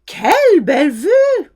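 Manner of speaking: loud, high French cry of admiration; demonstrated gushing exclamation, pitched right up. Shown as a demonstration. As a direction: exclaim it as if wowed by the sight. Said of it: "Exclamations using quelle".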